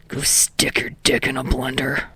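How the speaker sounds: low, curt snarl; biting retort. A narrator's reading. Katsuki snapping at classmates as he sits. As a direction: bite it out low. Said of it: "Katsuki bites out".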